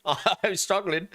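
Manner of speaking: high, laughing through the words; laughing struggle. Real, not acted. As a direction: giggle while suffering.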